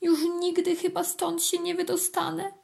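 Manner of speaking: high, wistful ant voice; sorrowful little ant. A narrator's reading. Ant thinks she will never get out, sorrowful. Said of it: "Żal jej się zrobiło".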